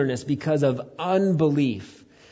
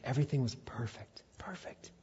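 soft, fading to a whisper; very quiet, dying to breath alone; hushed awe; 11 dB quieter than usual. Real, not acted. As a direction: let it sink away into a reverent whisper. Preacher dwells on finished creation.

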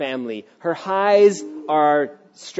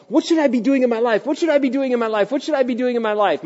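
quick, higher, anxious repeating; anxious repeated question; 8 semitones higher, faster than usual. Retold or imitated. Believer asking God, quick anxious repetition. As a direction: rattle it off like a restless seeker. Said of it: "we ask ourselves".

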